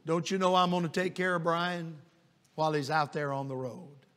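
tender retold comfort; gentle reassurance.

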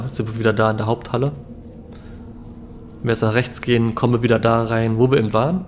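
ordinary delivery

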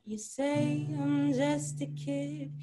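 ordinary talk, nothing marked

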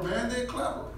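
ordinary talk

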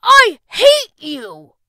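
put-on slow loud screaming boy; furious yell. A narrator's reading. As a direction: scream it at the door. Boy hurls mirror, yells.

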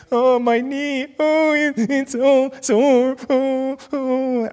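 hammed-up little boy crying in pain; same wailing youngster. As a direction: wail over a sore knee, words stretched out. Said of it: "The same youngster comes in, same".

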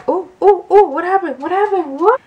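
high, rapid-fire alarmed questions; startled. Real, not acted. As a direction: blurt it out in sudden surprise. Something suddenly goes wrong.

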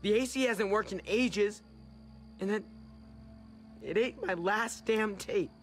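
put-on child sulking and complaining; bad mood. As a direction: grumble out a list of grievances. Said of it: "I'm in a bad mood".

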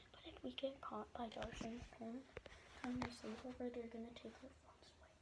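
hushed secret whisper. Real, not acted. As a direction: whisper so parents don't hear. Sneaking at night, whispering.

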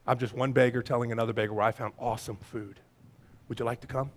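low, soft, modest answer; humble reply. Acted. Believer replying to a curious onlooker, low and humble. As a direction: answer gently, pitched well down. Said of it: "And you say".

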